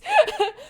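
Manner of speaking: a short high laugh; quick laugh, voice jumping up; burst of laughter. Real, not acted. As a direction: laugh out at the twist. Laughs at own joke.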